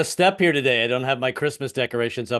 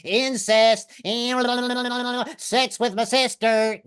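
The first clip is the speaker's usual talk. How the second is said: high, ringing auctioneer's call; Southern auctioneer; 9 semitones higher, slower than usual. Acted. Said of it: "sound like auctioneers".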